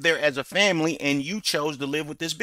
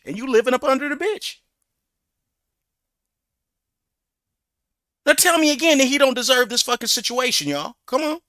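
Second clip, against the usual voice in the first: exasperated raised voice; 8 semitones higher, 5 dB louder than usual. Real, not acted. Exasperated rant at guest.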